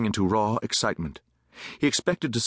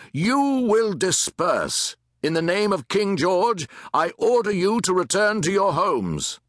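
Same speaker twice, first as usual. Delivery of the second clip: high, projected, opening very slowly; commanding proclamation; 9 semitones higher, 4 dB louder, slower than usual. A narrator's reading. Captain pacing before troops orders crowd, loudly commanding. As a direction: proclaim it with full authority.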